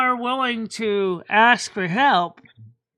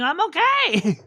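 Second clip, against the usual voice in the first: mock cheery denial; 9 semitones higher, 4 dB louder than usual. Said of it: "you're sitting there going".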